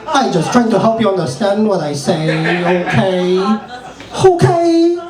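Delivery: high-pitched, hammed-up Chinese accent; exaggerated Chinese accent, high and stretched out; mock Chinese accent. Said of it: "like, China and be like".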